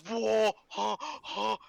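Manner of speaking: high, loud frightened gasps; seeing police at the stairs, scared gasping; retold startled gasp. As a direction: gasp as if seeing armed officers.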